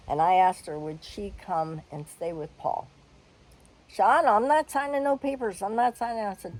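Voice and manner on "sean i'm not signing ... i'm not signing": higher, louder flat refusal; imitating the sister's louder, higher no; indignant refusal. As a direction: refuse the papers outright. Sister refusing to sign papers.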